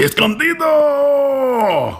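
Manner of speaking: very high, long-drawn shout with a Mexican accent. Shown as a demonstration. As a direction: belt it out like a hammed-up Mexican wrestling cry. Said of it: "an accent that is stereotypical".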